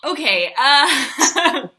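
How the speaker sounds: loud, high, drawn-out "uh" into laughter; cracking up. Real, not acted. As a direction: thrown off, bursting into laughter. Cracks up at an edgy joke.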